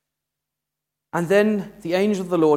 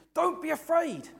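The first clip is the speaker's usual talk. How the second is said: high reassurance; 11 semitones higher, 3 dB quieter than usual. Retold or imitated. Angels' usual first words.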